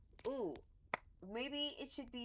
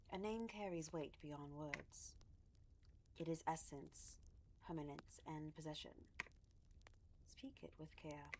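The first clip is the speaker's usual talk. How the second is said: regal voice; 4 semitones lower, 12 dB quieter than usual. Acted. Reading the game text aloud in a light regal voice. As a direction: read it lightly regal, measured. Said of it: "Let's do my regal voice".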